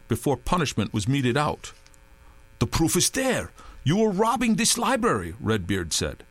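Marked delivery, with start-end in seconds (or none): 2.6-5.4 accusing. A narrator's reading. Redbeard accusing.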